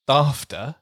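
"Dafter" with a short flat "a", "DAHF-ter"; daughter like laughter. Shown as a demonstration. Host demoing the swap, daughter said like laughter. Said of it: "daughter was pronounced like laughter".

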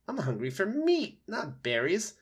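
high, indignant fox. A narrator's reading. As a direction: scoff at the berries. Fox character.

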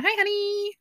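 high-pitched mock "hi honey"; put-on sugary, high coworker voice; imitated coworker. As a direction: sing out a teasing greeting way up high. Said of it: "she'd text me".

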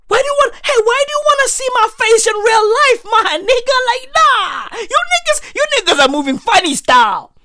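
squeaky high indignant rant; high-pitched rant. Acted. Comic high-pitched rant at the Uber driver about his face. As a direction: pitch up high and berate him.

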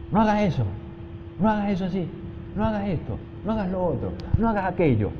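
high-pitched scolding no, no, no; mock yelling at a child. Shown as a demonstration. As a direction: nag a small child sharply, every no up high. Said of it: "y gritarle".

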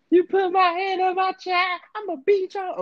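very high, drawn-out wife voice; mock outraged wife. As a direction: threaten slowly in a squeaky put-on high pitch. Voicing the wife's angry threat.

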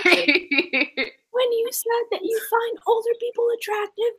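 ordinary talk

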